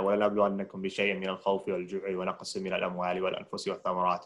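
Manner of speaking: Quran recitation. Real, not acted. Quoting a Quran verse.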